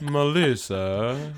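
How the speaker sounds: slow, drawn-out snooty Melissa; Niles voice. Pretend. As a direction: stretch it out like a fussy Niles. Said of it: "in my Niles voice".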